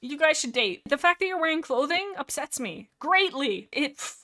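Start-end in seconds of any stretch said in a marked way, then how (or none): none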